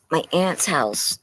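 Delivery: usual speaking voice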